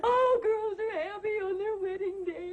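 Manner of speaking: bawling through the words; weepy bride protesting her joy; happy crying. Acted. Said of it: "she's gonna drown herself".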